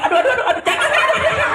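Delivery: high squealing cries of pain; monkey crying out. Acted. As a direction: yelp like a hurt little monkey. Monkey hit by cow, cries out. (jeritan monyet kesakitan, suara tinggi)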